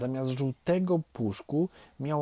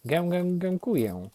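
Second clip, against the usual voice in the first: a raised, lightly honked voice; honking duckling; 7 semitones higher, 4 dB louder than usual. A narrator's reading. Ugly duckling thanks the nestlings, light honking. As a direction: honk lightly like a little gosling. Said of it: "zagękało brzydkie kaczątko".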